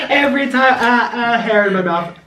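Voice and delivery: laughing through the words. Real, not acted. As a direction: breaks into real laughter. Laughing at hair in mouth.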